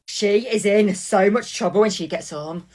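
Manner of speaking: ordinary talk, nothing marked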